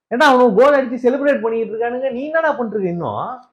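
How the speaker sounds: high-pitched incredulous scolding; exasperated outrage. Acted. Player berating defender after conceding a goal, exasperated. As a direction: scold at the top of your range.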